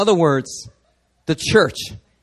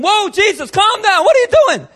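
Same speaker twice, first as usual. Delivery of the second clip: much higher, louder startled cry; alarmed disciples; 7 dB louder than usual. Retold or imitated. Disciples startled by Jesus, crying out in alarm. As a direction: yelp in shock at Jesus. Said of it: "And all of a sudden".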